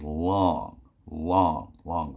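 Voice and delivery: each LONG stretched out slowly; drawn-out emphasis. Real, not acted. Storyteller stressing the era before GPS, drawing out LONG. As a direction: drag out every "long" for emphasis.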